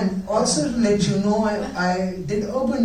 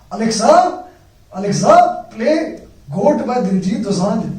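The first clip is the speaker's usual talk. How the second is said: put-on loud, slow Punjabi accent calling Alexa; [name] Punjabi accent; 3 semitones higher, 7 dB louder, slower than usual. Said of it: "the accent is so good".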